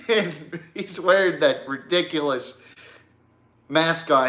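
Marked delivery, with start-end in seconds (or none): 0.1-2.5 amused, words breaking into chuckles; can't keep the laugh out; laughing while talking. Real, not acted. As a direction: talk through real laughter, pitched up. Cracking up at his own story.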